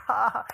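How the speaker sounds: high, put-on ha-ha; mock child's laugh; imitated laughter. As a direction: mimic a little one joining in. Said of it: "Olive's laughing".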